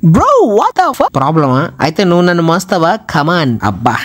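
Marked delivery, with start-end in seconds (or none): 0.0-1.1 slow, high, outraged "what the"; drawn-out shocked outburst; shocked disbelief. Real, not acted. Shocked reaction.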